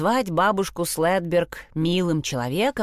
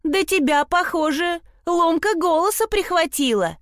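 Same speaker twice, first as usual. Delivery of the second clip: mocking call, much higher; teasing shout; 11 semitones higher than usual. A narrator's reading. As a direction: jeer at the boy's cracking voice. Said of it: "крикнула Мелани с кровати".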